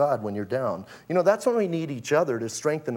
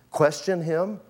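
normal talking voice

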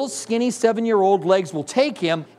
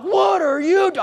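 scared parent; 10 semitones higher than usual. Retold or imitated. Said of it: "I looked like I was insane".

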